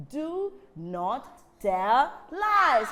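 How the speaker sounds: very slow, firm moral. Shown as a demonstration. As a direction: stress each word slowly for children. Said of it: "Can we say it louder".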